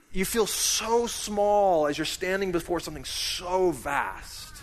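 usual speaking voice

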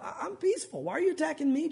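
high, soft protest; pleading woman. Acted. Peaceable woman asking Joab why he attacks her, pleading.

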